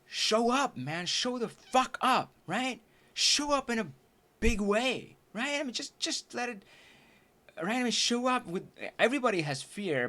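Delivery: high, insistent motivational urging; imitated coach pep talk. Business coach urging student to show up, insistent.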